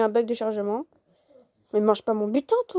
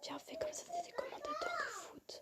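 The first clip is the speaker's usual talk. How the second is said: hushed whisper to her sister; unvoiced aside, barely audible; 13 semitones higher, 23 dB quieter than usual. Real, not acted. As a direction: whisper the plan off mic.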